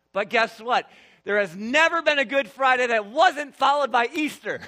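loud, lifted proclamation; rousing preaching. Acted. Pastor proclaiming Easter to the congregation, loud and bright. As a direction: burst out with the good news.

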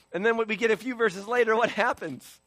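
ordinary talk

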